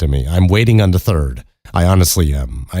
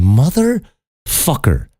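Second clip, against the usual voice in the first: slow, pitched-up exasperated swear; high drawn-out curse; 8 semitones higher, much slower than usual. Retold or imitated. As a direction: stretch it out high in outrage. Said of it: "And I'm going".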